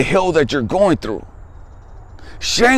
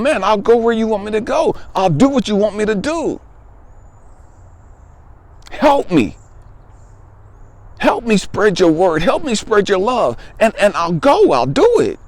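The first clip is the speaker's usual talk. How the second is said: higher, pleading prayer to God; retold plea; 6 semitones higher than usual. Boy pleading with God for five things, higher and desperate. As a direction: beg God like a desperate six-year-old. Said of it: "I just pleaded with God".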